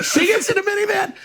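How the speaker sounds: high, laughing through the line. Real, not acted. Retelling mom's minivan trip, giggling.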